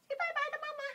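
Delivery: very high sing-song bye-bye. Real, not acted. Sitter telling the cat to say bye to its owner in very high baby talk. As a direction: coo goodbye up high.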